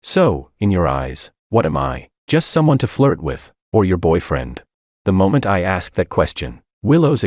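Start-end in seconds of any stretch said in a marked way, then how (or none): none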